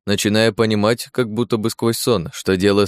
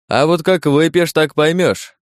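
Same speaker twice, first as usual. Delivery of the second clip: higher-pitched, lively interjection; задорно перебивает; 7 semitones higher, 3 dB louder than usual. Acted. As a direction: cut in high and brisk, as a tipsy guest. Подьячий перебивает батюшку. (задорно, повышенным голосом)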